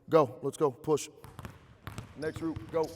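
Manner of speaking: shouted drill commands; barking gym coach. Real, not acted. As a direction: bark go and push across the gym. Coach calling drill to players.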